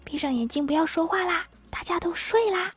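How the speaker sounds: soft whispered bedtime urging; hushed mother. Acted. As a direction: hush the children to sleep. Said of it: "他们妈妈说".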